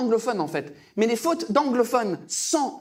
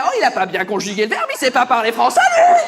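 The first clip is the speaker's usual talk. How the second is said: mock outraged Frenchman, building to a louder laughing shout; indignant French emailer; 8 dB louder than usual. French person reading a colleague's email, mock outrage. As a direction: scoff at a bad conjugation, louder at the end. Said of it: "qui fait".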